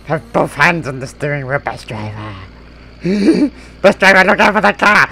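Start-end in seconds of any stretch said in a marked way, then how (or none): 3.8-5.1 loud, high yell between laughs; shouted warning. Pretend. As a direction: warn him at full voice. Near crash, shouting warning.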